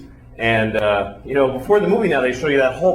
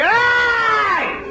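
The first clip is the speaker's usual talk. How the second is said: a sudden high yell of Hey!; shouted call. Acted. Drunk at three in the morning yelling out. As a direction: bark it out across the park at night.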